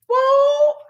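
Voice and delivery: loud, very high exclamation; overwhelmed falsetto hoot; high whoop. Real, not acted. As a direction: let out a real high-pitched whoop. Exclaims, overcome, before laughing.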